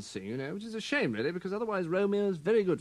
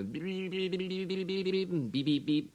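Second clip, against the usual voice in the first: rapid monotone gibberish beeping, pitched up; mad babbling beeps; 3 semitones higher, 3 dB quieter than usual. Retold or imitated. Actor sketching Lear going mad, babbled beeping. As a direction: burble softly like someone off his nut. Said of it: "goes right off his nut".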